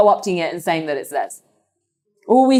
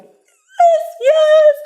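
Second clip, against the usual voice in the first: high, loud cry of glee; gleeful cry; 6 dB louder than usual. Acted. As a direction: shriek with secret delight. Duryodhana gets the army.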